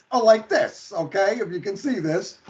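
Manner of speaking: ordinary talk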